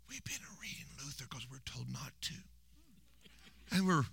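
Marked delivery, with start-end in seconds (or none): 0.1-2.3 a secretive whisper, like a confession. Retold or imitated. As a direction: breathe it out as a forbidden secret. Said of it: "And they go".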